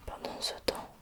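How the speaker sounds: ordinary delivery, nothing marked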